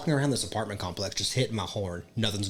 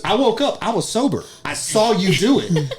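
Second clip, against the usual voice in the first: higher, louder accusing voice; indignant friend; 8 semitones higher, 5 dB louder than usual. Retold or imitated. Angry roommate accusing him, higher and louder. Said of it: "he goes".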